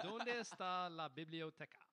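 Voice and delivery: slow, loud, over-careful classroom Spanish; hammed-up gringo Spanish; mock textbook Spanish. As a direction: recite it like a first-week Spanish student. Mock high-school Spanish line.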